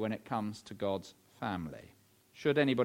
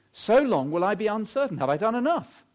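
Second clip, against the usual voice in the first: preaching climax; 9 semitones higher, 5 dB louder than usual. Acted.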